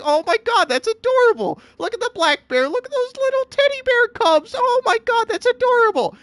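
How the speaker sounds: high squealing gush over the bear cubs. Retold or imitated. Reacting to a bear cub video, high cooing gush. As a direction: coo like you just saw baby animals. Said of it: "And I'm like".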